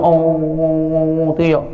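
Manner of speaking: slow, drawn-out imitated wail; the preacher mimics a divorced young wife wailing; mock wailing.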